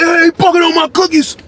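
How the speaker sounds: high-pitched shouting about the cookies; shouted cellmate impression. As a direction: yell like the angry cellmate. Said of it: "You like".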